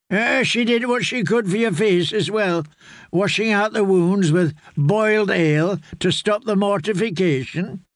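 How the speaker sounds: put-on an old caretaker explaining gently; lightly voiced old man telling the tale; old man's voice. Grey man tells of Jane.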